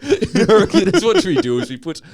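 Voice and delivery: loud, high mock Irish, laughing; Irish accent. Co-host cracks up retrying his line, Irish accent. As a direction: squeal out a bad Irish accent.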